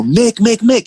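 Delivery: high, restrained yell of a name; retold yell. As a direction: mimic calling up to someone on stage. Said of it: "and I yell".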